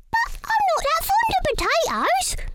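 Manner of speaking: high squeaky little mouse voice. Acted. Missus Mouse talks to herself about the food, squeaky high. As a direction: pipe up like a tiny mouse.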